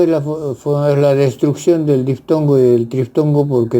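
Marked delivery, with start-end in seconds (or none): none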